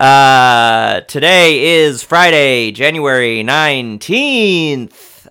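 loud, hyped show-opening welcome; radio host. Acted.

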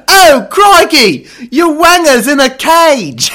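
high, loud, slow hammed-up Australian exclamation; mock Australian. Aussie bloke at the urinal exclaims in hammed-up Australian shock. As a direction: play an over-the-top Aussie bloke, astonished. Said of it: "that's Australian right there".

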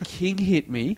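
ordinary talk, nothing marked

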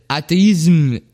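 atheism pronounced the French way, "athéisme"; French accent. Shown as a demonstration. Host mock-translating Derrida's word for listeners, French accent. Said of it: "that's an accent".

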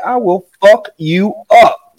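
slow, deliberate menacing warning; slow threat. Retold or imitated. Father pinning son, slow deliberate threat. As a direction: spell out every word so he gets it.

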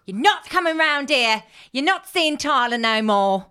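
loud, high East End mum shouting at her ex; East End accent. Retold or imitated. Podcaster voicing angry mum Tracy in a loud East End accent. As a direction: yell it like an EastEnders row, London accent. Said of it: "I'm all over. I'm, like, in Northern. I'm gone to East End".

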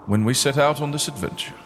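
a hint of a grieving captain addressing the crew; captain rallying the mourners; captain's eulogy. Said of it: "says Captain Salias".

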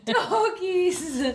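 high mock "Door keys", falling away; hammed-up, giggly "Door keys"; mimicked pronunciation. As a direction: parrot "Door keys" back high, through laughter.

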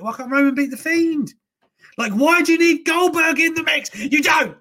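fired-up wrestling fan outrage; exasperated rant. Real, not acted. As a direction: pitch shoots up in real frustration. Goldberg rant, rising frustration.

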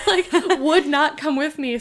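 ordinary talk

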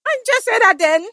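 high, exasperated questioning. Retold or imitated. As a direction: ask in disbelief.

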